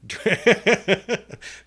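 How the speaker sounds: high burst of giggles; high-pitched amused laughter; giggling laugh. Real, not acted. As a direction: crack up midway through your story. Cracks up telling own anecdote.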